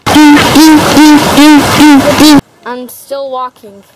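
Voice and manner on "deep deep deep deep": building to a loud shout; yelling. Real, not acted. Wading into deep cold lake.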